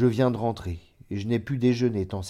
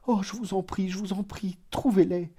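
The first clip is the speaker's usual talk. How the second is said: much higher, begging cousin's voice; pleading in anguish; 10 semitones higher than usual. A narrator's reading. Cousin begs narrator for the money, high anguished plea. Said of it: "poussa une sorte de cri de souffrance".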